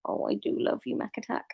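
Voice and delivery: soft unvoiced whisper of love. Real, not acted. Owner whispers fondly to her dog Mac Attack.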